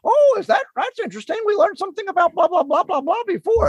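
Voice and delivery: very high goofy puppet voice; sock puppet voice. Acted. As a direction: play a silly sock puppet teacher. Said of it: "It's a very sock puppet voice".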